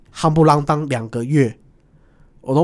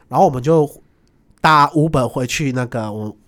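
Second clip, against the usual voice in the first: ordinary delivery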